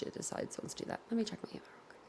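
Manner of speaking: breathy whispered aside to herself. Real, not acted. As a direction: mutter it under the breath. Aside while browsing.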